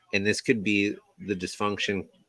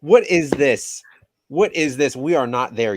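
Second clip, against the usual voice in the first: exasperated shout; 6 semitones higher, 8 dB louder than usual. Real, not acted. Exasperated co-host outburst.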